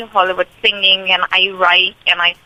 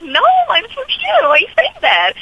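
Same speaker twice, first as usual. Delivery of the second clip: higher, incredulous protest; retold disbelief; 14 semitones higher than usual. Actress telling strangers she is local, high incredulous protest. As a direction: protest it like you can't believe them. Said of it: "And I'd be like".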